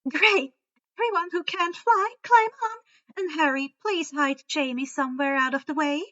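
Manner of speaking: high, loud hurried orders; impatient instructions. A narrator's reading. Luna ordering her boyfriend and the others, impatiently. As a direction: rush everyone aboard. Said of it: "Luna instructed her boyfriend impatiently".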